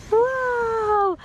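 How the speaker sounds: high, loud amazed whoa; delighted whoa. Real, not acted. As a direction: marvel at the little one. Toddler finishes slide.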